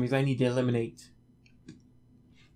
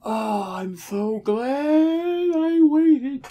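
sarcastic relief; 11 semitones higher, 6 dB louder, much slower than usual. Acted. Sarcastic slow mock relief.

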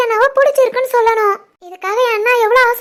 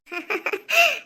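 soft delighted laugh; 3 semitones higher, 7 dB quieter than usual. Acted. Grandmother-to-be delighted.

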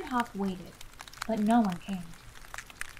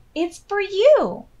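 joyful reveal; 11 semitones higher, 12 dB louder, slower than usual. Acted. Hop presents the surprise gift.

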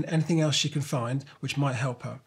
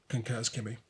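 plain reading voice

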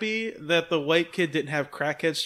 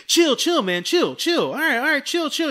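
pleading; 8 semitones higher, 4 dB louder than usual. Retold or imitated. Kid begging after getting punched.

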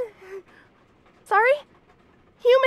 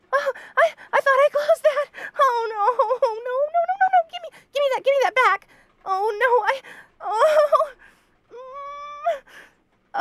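high, flustered panic, tripping over the words; panicked flustered; 6 semitones higher than usual. Acted. Bunny girl caught by her roommate, panicking. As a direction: caught out and scrambling to hide it. Caught with embarrassing YouTube videos.

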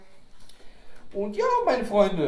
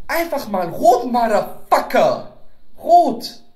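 much higher, much louder, slowed yell of delight; loud, ecstatic outburst; excited shout; 7 semitones higher, 10 dB louder, slower than usual. Real, not acted. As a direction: shout it out thrilled by the colour. Thrilled by red CD.

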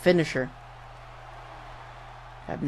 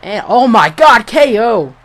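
excited; 5 semitones higher, 11 dB louder than usual. Real, not acted. Knockout call, shouting.

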